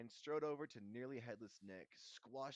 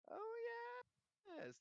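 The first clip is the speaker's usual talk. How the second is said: high, unconvincing agreement; polite lie. A narrator's reading. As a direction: agree with fake enthusiasm. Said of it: "they lied".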